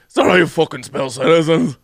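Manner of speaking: thick mock Irish brogue; slurry comic Irish drunk accent; Fitzy. Host correcting co-host's impression, hammed-up Irish brogue. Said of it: "I think he's Irish".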